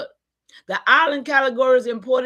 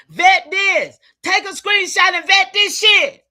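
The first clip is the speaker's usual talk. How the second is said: frustrated shout; 5 semitones higher, 6 dB louder than usual. Real, not acted. Frustrated preacher urging audience.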